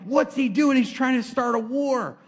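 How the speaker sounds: exasperated king, lightly acted; alarmed king. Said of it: "The king is like".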